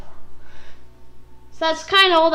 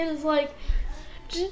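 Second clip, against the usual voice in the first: ordinary delivery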